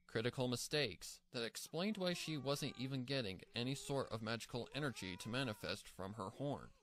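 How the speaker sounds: ordinary talk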